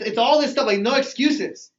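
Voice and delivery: ordinary delivery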